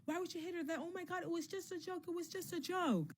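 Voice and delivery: high-pitched mock clamor, held back in volume; mock yelling. Friends protest after she hit Lorax, high mock yelling. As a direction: imitate a crowd protesting in a high, soft squeal. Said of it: "Everybody starts yelling".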